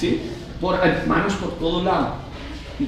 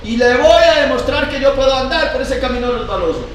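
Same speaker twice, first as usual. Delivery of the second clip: cocky boast, a bit louder; boastful person; 7 semitones higher, 4 dB louder than usual. Retold or imitated. Preacher voicing a boastful youth, cocky. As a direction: brag you can walk the slippery road. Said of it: "dicen así".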